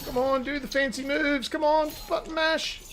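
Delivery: high, excited urging; urgent egging-on. Real, not acted. Urging on in final moments.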